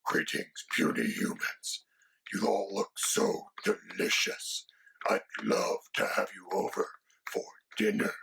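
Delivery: whispered dragon voice, playfully menacing. Acted. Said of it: "Say hi, Quill".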